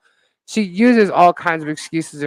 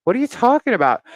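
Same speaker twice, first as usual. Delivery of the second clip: incredulous; 7 semitones higher, 4 dB louder than usual. Retold or imitated. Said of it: "I'm like".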